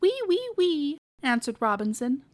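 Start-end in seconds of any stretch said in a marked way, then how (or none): 0.0-1.0 high piglet wee-wee, much higher; little French pig. Acted. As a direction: answer like a little Frenchman's oui oui. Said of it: "like a little Frenchman".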